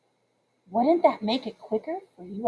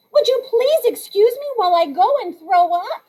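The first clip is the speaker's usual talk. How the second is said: higher, mock-polite sarcasm; sarcastic comeback; 8 semitones higher, 5 dB louder than usual. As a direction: fake politeness dripping with sarcasm. Narrator's sarcastic comeback to Tiffany.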